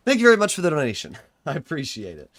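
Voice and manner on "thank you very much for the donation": fast, loud, exaggerated thank you; shouted thanks. Real, not acted. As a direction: blurt the thanks out loud and quick. Louder repeat of same thanks.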